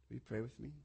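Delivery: soft closing prayer; quiet closing prayer. Acted. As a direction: pray gently over the room. Leads closing prayer softly.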